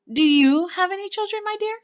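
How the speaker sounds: high sing-song polite question, swooping up; loud, high-pitched "my dear" inquiry; sweet prying grandmother. A narrator's reading.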